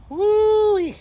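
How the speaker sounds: long, high, loud "woo-wee"; amazed whoop. Real, not acted. Man whooping over what he saw this morning, amazed. Exclaiming over a shocking sight.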